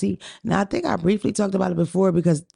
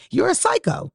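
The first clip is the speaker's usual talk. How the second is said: ordinary talk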